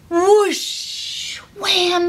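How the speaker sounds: high excited whoosh wham; whoosh wham cheer. Shown as a demonstration. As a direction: call it out big. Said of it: "it's my favorite part".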